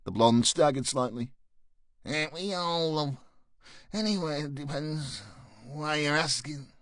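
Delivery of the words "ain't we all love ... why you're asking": higher, slurred tarty voice; lightly voiced stoned street girl; drunk streetwalker.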